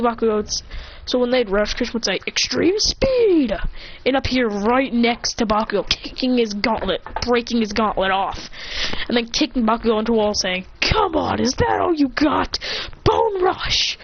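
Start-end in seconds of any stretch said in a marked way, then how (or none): 2.3-3.6 high, drawn-out anime move call; attack call. Acted. Hero rushing at Bakugo, high drawn-out attack call. 10.8-14.0 higher-pitched challenge rising into an attack cry; fighting taunt. Acted. Hero kicking Bakugo, taunt building to attack call.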